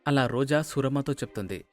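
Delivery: ordinary delivery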